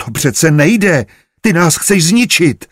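loud, high outcry of protest; alarmed cry. A narrator's reading. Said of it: "vykřikla Rozárka".

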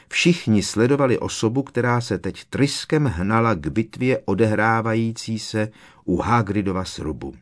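ordinary delivery

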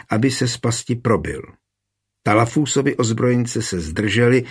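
ordinary delivery, nothing marked

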